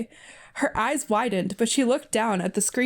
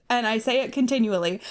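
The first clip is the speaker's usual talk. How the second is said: usual speaking voice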